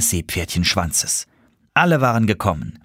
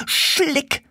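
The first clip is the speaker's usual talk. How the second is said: high little seahorse curse; cursing. Acted. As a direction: spit out the curse word high and annoyed. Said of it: "fluchte sie". (hoher, ärgerlicher Fluch)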